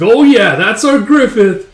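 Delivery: very high, loud celebration; acted jubilant cheer. Band celebrates leader's win, jubilant shout. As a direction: cheer your hero like a fan.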